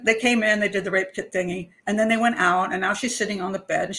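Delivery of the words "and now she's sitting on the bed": ordinary delivery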